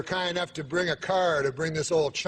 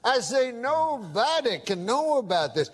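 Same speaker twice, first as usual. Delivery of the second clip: put-on drawling old cowpoke voice, a touch higher; prospector accent; 3 semitones higher than usual. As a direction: tough old hombre swearing his friend to secrecy. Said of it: "I can tell by the accent".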